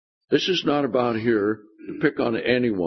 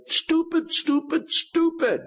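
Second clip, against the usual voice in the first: high, exasperated self-scolding; slower than usual. Retold or imitated. Pastor mocking his own upset self, exasperated. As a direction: berate yourself in a burst of frustration. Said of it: "after I got all, all upset, I said".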